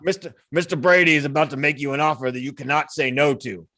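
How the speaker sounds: put-on low mobster with botched Italian accent; bad Italian accent. Henchman passes on Brady's offer, bad Italian accent. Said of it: "the worst Italian accent anyone's ever attempted".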